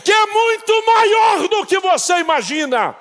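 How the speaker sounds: full-force preached proclamation; shouting voice of God. Retold or imitated. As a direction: thunder God's promise over the church. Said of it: "Deus me disse".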